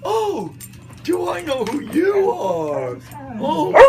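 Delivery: high, loud, slow sarcastic shouting; mocking a man asking if he is known. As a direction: shout it out in fake awe. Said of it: "I go".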